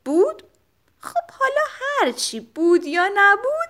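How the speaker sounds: high playful questioning; teasing the kids. A narrator's reading. Narrator teasing the children after the story, high and playful. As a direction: tease the children with a game.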